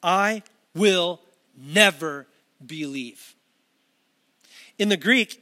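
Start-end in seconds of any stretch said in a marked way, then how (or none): 0.0-3.3 each word set apart, very slow; slow, weighty, deliberate refusal; emphatic. Retold or imitated. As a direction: land I WILL NEVER BELIEVE word by word with pauses. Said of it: "it's emphatic".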